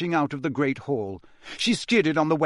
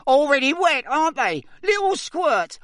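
very high, taunting imp voice; loud, squeaky poltergeist mockery; mischievous poltergeist; 13 semitones higher, 5 dB louder than usual. A narrator's reading. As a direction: jeer gleefully at your victims. Poltergeist taunts soaked students.

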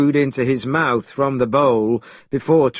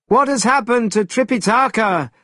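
high-pitched call from the air; shouted; 9 semitones higher than usual. A narrator's reading. Monkey calling down to his companions, shouted. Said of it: "shouted Monkey from the air".

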